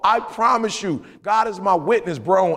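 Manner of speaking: ordinary delivery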